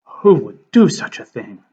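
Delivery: hammed-up scandalized question; mock shock. Teacher reacting to Tom's rant, in mock shock. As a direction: pretend to be utterly appalled.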